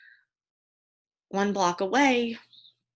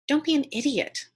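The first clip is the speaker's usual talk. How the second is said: higher-pitched, dismissive mother's rebuke; scolding mother; 8 semitones higher than usual. Retold or imitated. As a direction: imitate a mother brushing off her child. Mother scolds her at dinner.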